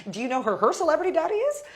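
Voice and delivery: high, loud, fast name-drop; excited boast. Retold or imitated. To the dancer about Maisie's father, fast high excited brag. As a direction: rush it out, thrilled.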